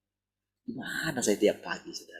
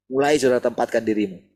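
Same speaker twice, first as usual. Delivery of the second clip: normal talking voice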